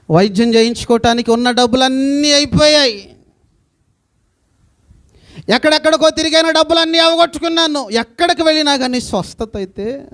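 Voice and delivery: full-voiced lament from the pulpit; impassioned preaching. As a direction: preach her despair out to the crowd. Preacher voicing sick woman's despair.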